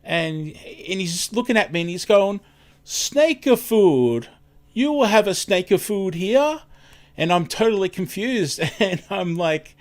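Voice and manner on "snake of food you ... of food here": put-on higher, slow puzzled Italian accent; Italian-accented customer asking, higher and slower. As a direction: imitate the confused customer in a thick Italian accent. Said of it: "really heavy, heavy accent".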